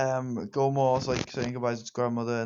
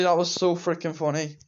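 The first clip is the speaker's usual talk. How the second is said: normal talking voice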